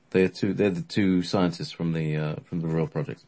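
ordinary delivery, nothing marked

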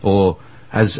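ordinary delivery

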